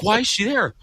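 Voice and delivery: high-pitched mock panic; all worked up. Neighbor girl at the door, homeowner all worked up. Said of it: "I'm all worked up".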